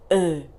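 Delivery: E as a neat, rounded French "euh"; pretty French E. Shown as a demonstration. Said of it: "it needs to sound pretty".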